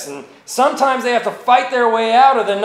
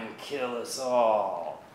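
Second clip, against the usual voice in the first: low menacing whisper; 9 semitones lower, 11 dB quieter than usual. Acted. Poem's menacing final threat.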